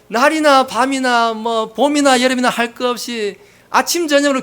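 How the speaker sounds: acted sarcastic nagging; mock taunting. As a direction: pile on the jeering like a nosy bystander. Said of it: "그렇게 비아냥댄다는 거예요".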